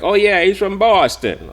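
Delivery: high, loud mock Boston accent; hammed-up, badly faked Boston accent; terrible Boston accent. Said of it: "terrible Boston accent".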